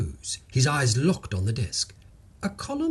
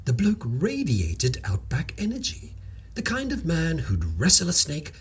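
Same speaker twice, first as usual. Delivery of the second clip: plain reading voice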